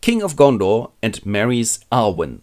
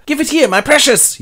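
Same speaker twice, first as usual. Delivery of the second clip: much higher and louder, grabbing; mock greedy whine; 5 dB louder than usual. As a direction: whine it like a possessive creature. Frodo grabbing the ring.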